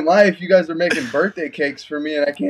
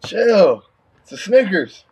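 exasperated plea; 6 semitones higher, slower than usual. Retold or imitated. Telling coaches to relax.

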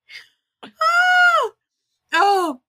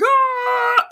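high, loud mock scream like E.T; 5 dB louder than usual. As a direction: scream with arms flapping, hammed up. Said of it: "running and screaming".

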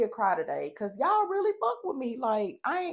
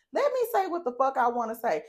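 ordinary delivery

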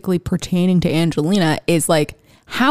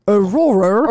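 Aurora with a mock Australian accent; slower than usual. Shown as a demonstration. Asked how Australians say it, mock Australian accent. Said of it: "how do you say Aurora in an Australian accent".

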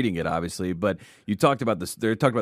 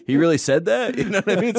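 high, louder startled question; pitched-up incredulous mock reaction; imitating surprised disbelief; 11 semitones higher, 6 dB louder than usual. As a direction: play Troy's shock at hearing the quote. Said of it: "And Troy's like".

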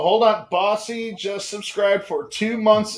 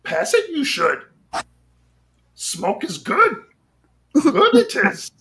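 Yoda impression; 6 semitones higher, 4 dB louder, slower than usual. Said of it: "that's a piss poor Yoda".

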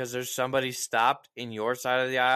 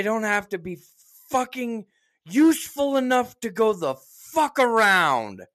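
high, loud, building fury at the fat shoppers; mock outraged rant; 7 semitones higher, 5 dB louder than usual. Venting to his wife about shoppers blocking the aisle, a loud mocking rant.

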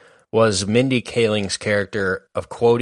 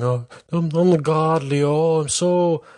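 higher, grand Oprah impression; lightly voiced larger-than-life host; 5 semitones higher than usual. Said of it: "Oprah being like this enchanting".

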